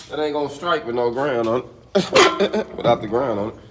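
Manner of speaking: half-numb mouth, slurred a little; numb from the dentist. Real, not acted. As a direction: talk through a numb mouth. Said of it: "Half of my mouth is still numb".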